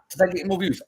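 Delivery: usual speaking voice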